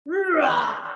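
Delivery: high, playful mock-angry growl of a daughter; a hint of a toddler's fury; mock rage. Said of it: "she rips it with such rage".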